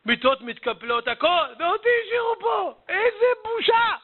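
climbing to a high-pitched cry; hammed-up outburst of hurt; acted wailing complaint. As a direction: build into an indignant high wail. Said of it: "מקופלת בתוך הארון ובוכה".

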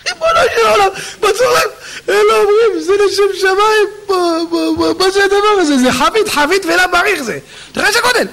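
high-pitched, incredulous exclaiming; hammed-up onlookers' astonishment; aghast amazement. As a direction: marvel in a high, appalled voice. Onlookers aghast at the huge eater.

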